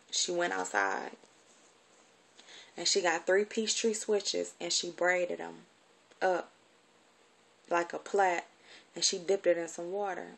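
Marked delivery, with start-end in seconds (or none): none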